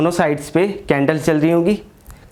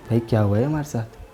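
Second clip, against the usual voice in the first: scared hush; 5 semitones lower, 6 dB quieter than usual. Retold or imitated. Friends terrified after ghost game.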